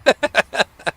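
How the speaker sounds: high, loud wheezing laugh. Real, not acted. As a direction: burst out laughing at your own punchline. Cracks up after the punchline.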